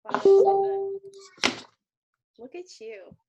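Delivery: usual speaking voice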